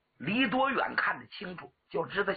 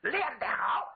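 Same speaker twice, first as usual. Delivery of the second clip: shouted cheer; 14 semitones higher than usual. Acted. Crowd applauds the axe display, shouting bravo. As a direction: burst out with a stretched, high cheer. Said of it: "鼓掌喝彩".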